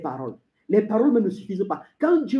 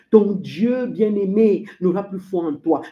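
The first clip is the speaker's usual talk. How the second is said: usual speaking voice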